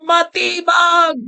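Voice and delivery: hammed-up taunting call of Matibag; mock challenging shout. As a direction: bellow the name slowly like a dare. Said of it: "gumaganyan siya".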